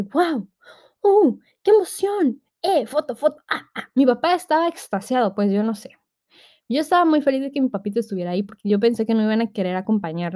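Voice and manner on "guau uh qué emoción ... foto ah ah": high, gushing "Guau, qué emoción" with slowed awe; imitating excited dad. Dad filming his daughter's tattoo, gushing with excitement. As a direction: play the thrilled dad filming. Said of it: "mi papá estaba emocionadísimo".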